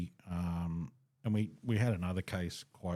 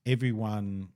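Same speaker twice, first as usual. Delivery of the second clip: ordinary talk, nothing marked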